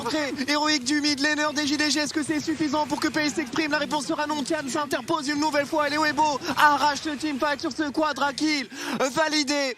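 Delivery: building hype play-by-play; esports caster. Acted. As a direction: climb toward the big kill.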